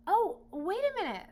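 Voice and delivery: high, loud sudden interruption; urgent boy. Acted. Boy halting the lion before being eaten, high and loud. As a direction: stop him urgently. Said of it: "said my father".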